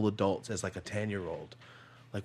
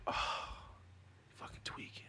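whispered cursing under the breath; hushed dismay; 14 dB quieter, slower than usual. Retold or imitated. Son passing a certain person's house, whispered dismay. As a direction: let it drop to a defeated whisper.

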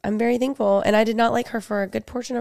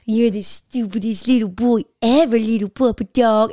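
slow, cooing puppy voice; sweet baby talk. Shown as a demonstration. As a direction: coo an insult lovingly at a dog. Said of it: "it's more of tone variations".

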